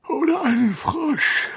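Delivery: high, drawn-out yawn through the words; gähnend. A narrator's reading. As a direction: yawn while you say it, slowly. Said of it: "gähnte Harry". (gähnend, hoch und gedehnt)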